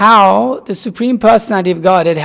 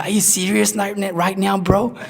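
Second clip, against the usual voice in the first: mock-incredulous California bro, softer; California guy; 7 dB quieter than usual. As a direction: lightly imitate a stunned California dude. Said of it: "he's a California guy".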